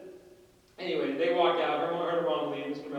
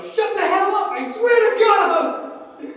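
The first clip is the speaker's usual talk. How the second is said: high, full-force teacher's shout; yelling; 11 dB louder, slower than usual. Retold or imitated. Teacher yells at the freaking-out class, shouting. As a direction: bellow it with drawn-out exasperation. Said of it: "rip out the cord and yell".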